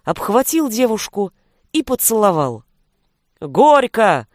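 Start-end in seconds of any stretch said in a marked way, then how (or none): 3.4-4.3 loud, rousing wedding-toast cry; thunderous roar. A narrator's reading. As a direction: bellow it out to the whole crowd. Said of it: "Точно гром заревел Небаба".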